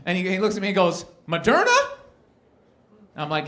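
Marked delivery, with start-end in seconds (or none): 1.3-1.8 high, loud naive "Moderna?"; dopey yoga guy, high and rising; clueless guy. Retold or imitated. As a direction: ask it up high like a total innocent.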